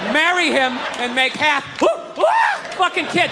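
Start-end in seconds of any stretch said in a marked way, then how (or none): 1.8-2.2 quick high mock whoops; bitchy noises. Kids of the scared passenger, imitated bitchy whooping. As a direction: imitate little panicky yelps. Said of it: "these bitchy noises".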